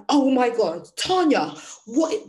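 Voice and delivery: imitating a stunned friend, high and loud; mock shock. Retelling someone's reaction to her crying, in mock shock. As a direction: exclaim it in retold disbelief.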